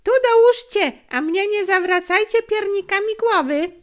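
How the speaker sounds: high, loud, insistent demand; boy's shrewd, forceful retort; sharp rebuff. A narrator's reading. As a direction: brush off the bribe loudly. Boy rebuffs the dealer's bribe.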